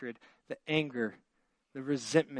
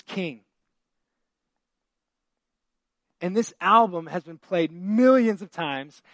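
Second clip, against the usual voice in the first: higher, louder, fast fervent sermon; excited preaching; 5 semitones higher, 7 dB louder than usual. Acted. Pastor to congregation, praising [name]'s album, excited preaching. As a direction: proclaim it with rising joy.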